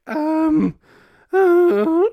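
failed squeak of microphone feedback, pitched far up; high strained squeak that falls apart; squeaky mic noise. Shown as a demonstration. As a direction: try to squeal like a mic and crack. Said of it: "I was supposed to do a squeaky, like, a microphone sound".

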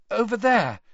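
high, shaken reply; shakily. Acted. Hermione pointing to where voice was heard, shakily. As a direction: answer with nerves showing. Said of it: "said Hermione shakily".

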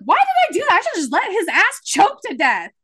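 high, loud, indignant rant; venting at herself, half-joking fury; mock outrage. Woman replays her own thought after the breakup, as an indignant rant.

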